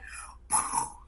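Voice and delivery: a sharp breathy gasp of delight; mock gasp. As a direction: gasp as if overjoyed. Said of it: "I was like".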